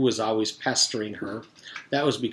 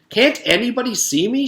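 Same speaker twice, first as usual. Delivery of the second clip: much higher, louder frantic plea; desperate plea; 12 semitones higher, 7 dB louder than usual. Acted. Allison pleading with the class, desperate. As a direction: beg to be noticed, panicking.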